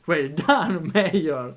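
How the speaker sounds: laughing, loud well done; cheering laugh. Real, not acted. Cheering the Mayor in game.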